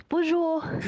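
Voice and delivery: put-on light French accent greeting. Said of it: "French accent".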